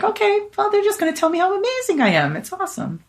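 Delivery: high, breezy pep talk to oneself; upbeat self-talk. Retold or imitated. Patient psyching herself up for a doctor visit, upbeat. Acting out her upbeat new attitude.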